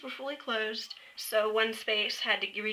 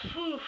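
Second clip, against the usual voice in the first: breathless whew; 7 semitones higher, 5 dB quieter than usual. Real, not acted. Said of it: "why I'm all out of breath".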